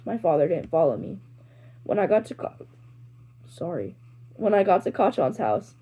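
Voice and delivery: ordinary delivery, nothing marked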